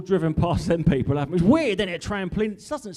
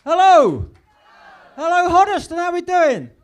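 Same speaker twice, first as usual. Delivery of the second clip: high, loud crowd hello; shouted greeting; 14 semitones higher, 11 dB louder than usual. Real, not acted. Comedian opening his set to the crowd, loud high shout. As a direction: bellow hello to the whole room.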